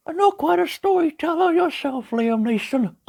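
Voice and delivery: gentle cartoon bear narrating; Pooh Bear voice. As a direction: read it as a gentle honey-loving bear. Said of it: "Pooh Bear, for reading".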